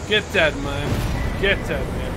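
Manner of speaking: drawn-out, smug get dead; slow gloating taunt. Real, not acted. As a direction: savour the win mockingly. Gloating over dead boss.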